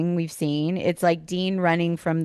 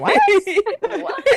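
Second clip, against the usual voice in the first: laughing what; 4 dB louder than usual. Retold or imitated. Laughing through the quoted What.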